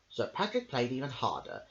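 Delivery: usual speaking voice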